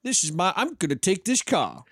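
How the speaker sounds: a cheeky Englishman stealing a car; mock English accent. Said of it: "give him an, uh, uh, English accent".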